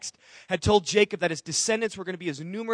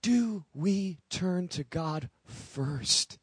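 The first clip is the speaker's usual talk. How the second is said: very slow and hushed, each word weighed; slow hushed question; 4 semitones lower, 9 dB quieter, much slower than usual. Acted. Preacher slowly asking the congregation a searching question. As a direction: let the question hang in the air. Repeated sermon question, slowed.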